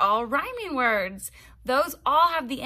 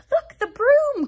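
loud, high-pitched cry of warning; alarmed cry; 13 semitones higher, 10 dB louder than usual. A narrator's reading. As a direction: cry out suddenly to alert everyone. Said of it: "cried the bat".

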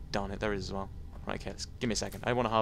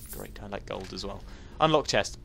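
ordinary talk, nothing marked

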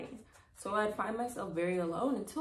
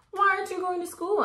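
put-on high, loud child's question; lightly voiced little girl asking; curious child; 12 semitones higher, 7 dB louder than usual. A narrator's reading. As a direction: ask it like a puzzled little girl. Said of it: "asked May".

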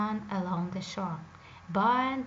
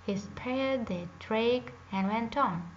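ordinary delivery, nothing marked

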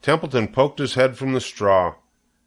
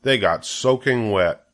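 plain reading voice

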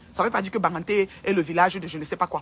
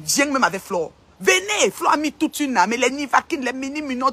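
ordinary talk, nothing marked

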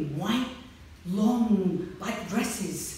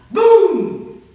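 booming shout, much higher and louder; 12 semitones higher, 19 dB louder than usual. A narrator's reading. Narrator booming the dragon's overhead roar. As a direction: make the boom burst out.

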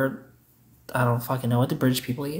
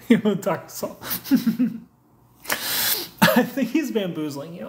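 higher, giggly repeat; tickled, laugh about to break out; amused, through laughter; 5 semitones higher than usual. Real, not acted. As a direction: say it again while recovering from a laughing fit. Repeats it amid a laughing fit.

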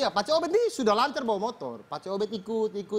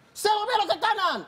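much higher, insistent protest; indignant reply; 10 semitones higher, 3 dB louder than usual. Retold or imitated. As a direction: insist you turned right. Minggus defends himself.

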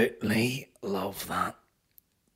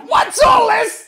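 very high, slow, loud laughing shout; laughing mimicry; 15 dB louder, slower than usual. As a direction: crack up while squealing it. Laughing imitation of the catchphrase.

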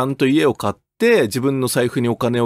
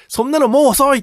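high-pitched mock scolding; imitated reproach, pitched well up; dismissive retort; 11 semitones higher, 4 dB louder than usual. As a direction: cut them off, mimicking a bossy president. Retold scolding cuts someone off.

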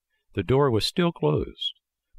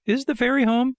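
very high little puppet voice; high-pitched puppet question; Pinocchio. A narrator's reading. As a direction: ask as a small, eager marionette. Puppet voiced, calling up to snail.

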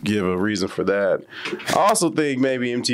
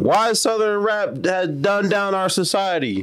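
yelling; 10 semitones higher than usual. Real, not acted. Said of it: "you don't have to yell".

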